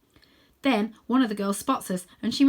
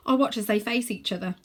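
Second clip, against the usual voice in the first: ordinary delivery, nothing marked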